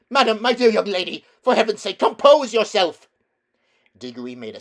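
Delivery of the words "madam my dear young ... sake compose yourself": high, loud, fast frightened plea; trembling scream. A narrator's reading. Uncle Andrew pleading with the witch, trembling scream. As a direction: beg her in a panic. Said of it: "in a sort of trembling scream".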